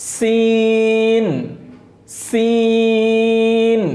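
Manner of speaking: সিন held long and even with a clear hiss; teacher stretches সিন; drawn-out sin. Shown as a demonstration. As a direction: slowly model the letter name সিন. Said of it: "সিনটা হবে আওয়াজটা".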